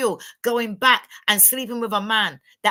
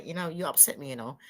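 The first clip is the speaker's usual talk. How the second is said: much softer, gentle and low; hushed, patient explanation, no raised voice; calm explaining; 6 semitones lower, 9 dB quieter than usual. Retold or imitated. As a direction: speak softly and evenly, as if reasoning with your man. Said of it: "I'll say".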